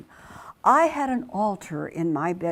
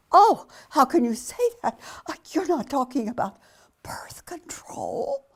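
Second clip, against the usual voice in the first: shocked outrage; 7 semitones higher, 4 dB louder than usual. Retold or imitated. Fiancé shocked.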